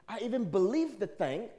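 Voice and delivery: ordinary talk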